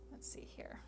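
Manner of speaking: whispered to herself; whispering. Real, not acted. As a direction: breathe it under your breath. Shuffling through cards, to herself.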